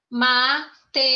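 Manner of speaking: "Matemática" in five separate syllables, ma, te, ma, ti, ca. Shown as a demonstration. Teacher counting syllables for the class, "Matemática" split syllable by syllable. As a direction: split "Matemática" with a clear gap after each syllable. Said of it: "Primeira sílaba".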